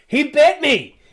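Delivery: high, loud shocked yell; acted outcry after a bite; surprised exclamation. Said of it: "illustrating her surprise again".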